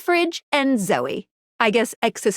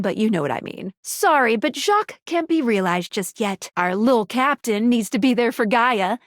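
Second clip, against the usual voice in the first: ordinary talk, nothing marked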